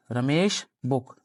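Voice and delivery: Ramesh बुक read slowly and evenly; slow, careful demo of Ramesh बुक; slow model reading. Shown as a demonstration. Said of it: "पढ़ेंगे इसको normal Ramesh बुक".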